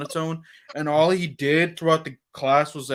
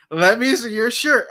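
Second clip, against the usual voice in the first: a louder, pushier teacher demand; "Let me see your shirt" said louder, higher, leaning hard on "shirt"; insistent teacher; 6 semitones higher, 6 dB louder than usual. Retold or imitated. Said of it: "that aggressive tone that she".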